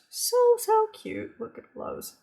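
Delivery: very high-pitched coo; gushing delight. Real, not acted. Crafter cooing over animal die cuts, gushing. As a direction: squeak it out, smitten with the cuteness.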